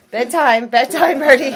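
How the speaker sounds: loud, rising call to the bird. Real, not acted. Calling the bird to bed.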